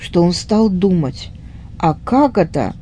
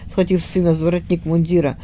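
usual speaking voice

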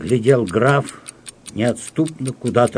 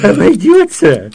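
high, loud mocking echo; sardonic scoff; 9 dB louder than usual. A narrator's reading. Count scoffing sardonically at his wife. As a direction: throw her word back with scorn. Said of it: "сардонически усмехнулся граф".

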